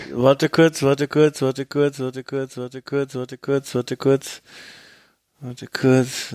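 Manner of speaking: repeating like a stuck record; skipping record. Pretend. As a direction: loop the phrase like a scratched vinyl, fading. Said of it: "[name], die Platte hängt schon wieder".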